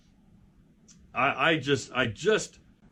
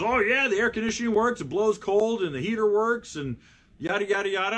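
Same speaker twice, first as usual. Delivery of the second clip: enthusiastic dad pitch; 9 semitones higher than usual. Retold or imitated. Dad selling his car.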